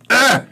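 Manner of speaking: short, high groan of disgust; disgusted "ugh". Real, not acted. Speaker embarrassed by a distant relative, disgusted groan. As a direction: recoil with a high, revolted "ugh".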